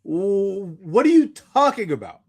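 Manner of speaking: usual speaking voice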